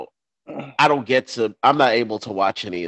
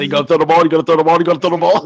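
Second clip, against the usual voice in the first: louder, higher teasing chant at a dog; mock dog-owner taunt; 5 semitones higher, 7 dB louder, much faster than usual. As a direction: tease it like waving a ball at a dog. Mocking someone teasing a dog.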